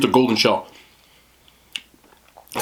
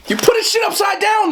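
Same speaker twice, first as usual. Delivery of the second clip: real frustrated shout, much higher; exasperated shout. Real, not acted. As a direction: yell at the cookie like it wronged you. Yelling at the upside-down Oreo.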